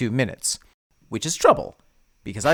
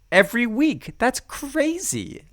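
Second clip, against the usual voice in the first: excited; 10 semitones higher than usual. Real, not acted. Excited, drawn out.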